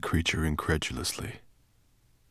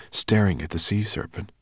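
ordinary delivery, nothing marked

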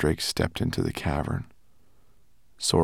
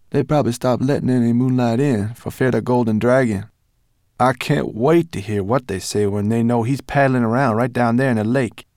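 a touch higher, eager voice; lightly voiced creature; 4 semitones higher, 7 dB louder than usual. Guide imagining the dragons' reaction, lightly eager. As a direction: relish the coming news. Character speaks.

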